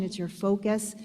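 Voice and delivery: usual speaking voice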